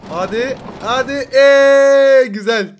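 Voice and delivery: loud high drawn-out cheer; loud rising cheer of delight; excited cheer. Real, not acted. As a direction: let out a thrilled whoop. Coaster ride begins.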